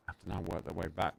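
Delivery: usual speaking voice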